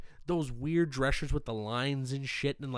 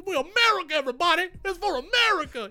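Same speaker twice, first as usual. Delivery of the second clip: much higher and louder mock cheering patriot; over-the-top patriot; 5 dB louder than usual. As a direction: hype the crowd like a flag-waving contestant. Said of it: "He walked around like".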